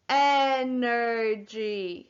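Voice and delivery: very slow ENERGY, syllable by syllable; said slowly. Shown as a demonstration. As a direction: stretch ENERGY into three long syllables. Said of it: "say the word slowly".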